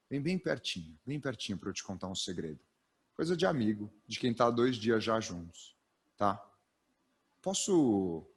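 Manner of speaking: low, hushed voice of a shared secret; secret confiding. Shown as a demonstration. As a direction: lean in and tell them a secret quietly. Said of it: "Era esse o jogo".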